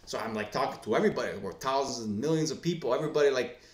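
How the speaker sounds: ordinary talk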